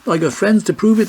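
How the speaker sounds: high, loud furious protest; in a fury. A narrator's reading. The stranger arguing with Enoch Davis, high and furious. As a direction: insist in a fury. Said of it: "said in a fury".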